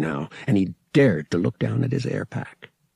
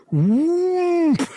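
much higher booming whoomp; imitated whoomp; 4 dB louder than usual. A narrator's reading. Ship shorting out, imitated whoomp. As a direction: throw out the crash noise. Crash sound.

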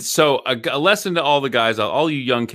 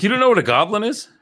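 much higher, disbelieving; can't believe what you heard; incredulous; 11 semitones higher, 4 dB louder than usual. Real, not acted. Incredulous reaction to the film.

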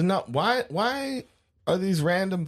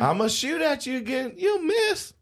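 very high put-on holler; 12 semitones higher than usual. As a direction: call it out way up high, as if from afar. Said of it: "And the rednecks yell back".

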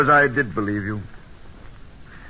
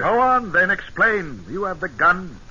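angry challenge; 8 semitones higher than usual. Acted. Angry confrontation with a spy.

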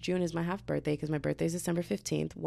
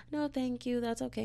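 high polite refusal; 8 semitones higher, 5 dB quieter than usual. Retold or imitated. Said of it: "I was just like".

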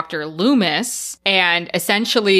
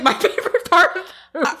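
fast, much higher, laughing through it; 11 semitones higher, much faster than usual. Real, not acted. A cohost laughing about her favorite scene. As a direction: giggle the words out.

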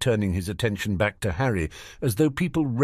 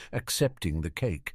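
ordinary delivery, nothing marked

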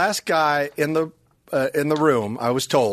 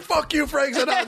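high-pitched retold yell; mock angry comeback; 10 semitones higher than usual. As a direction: throw it back as a high mock shout. Retold shouted comeback in the bit.

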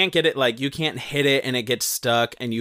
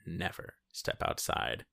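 prim murmur; 6 semitones lower, 11 dB quieter than usual. Retold or imitated. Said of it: "It's like".

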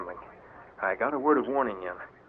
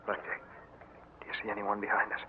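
much softer, worried undertone; nervous hush; 10 dB quieter than usual. Acted. Harvey to Blackjack fearing a follower, nervous hush. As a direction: murmur it, scared of being heard. Sneaking in darkness.

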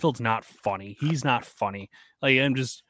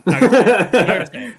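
louder, higher, laughing through the words; 9 semitones higher, 11 dB louder than usual. Real, not acted. Host laughing as he stops himself mid-rant, laughing. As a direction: laugh as you stop yourself from saying it.

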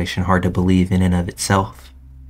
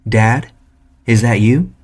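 raised, higher questioning call; 3 semitones higher, 5 dB louder, slower than usual. A narrator's reading. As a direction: call out to dad over the TV. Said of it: "I started yelling over the T V".

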